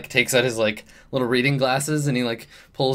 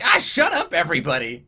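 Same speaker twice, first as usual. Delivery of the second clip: snapping goblin; 4 semitones higher than usual. Acted. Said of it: "Richard's like".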